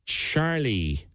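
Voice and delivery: usual speaking voice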